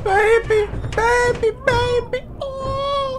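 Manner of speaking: put-on high wailing baby voice; baby cry imitation. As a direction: wail like a crying baby.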